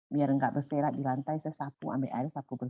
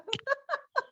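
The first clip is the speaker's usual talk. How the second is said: a burst of high laughter. Real, not acted. Laughing in disbelief to the host about the neighbor.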